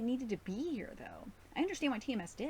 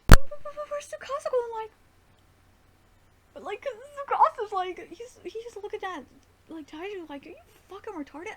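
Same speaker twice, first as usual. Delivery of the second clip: shrill rant; 11 semitones higher, 14 dB louder than usual. Real, not acted. Ranting about missing expression.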